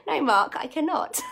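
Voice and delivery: real laughter through a firm no; turning down twenty-nine burpees through laughter; laughing refusal. Real, not acted. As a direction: laugh it off at Mark.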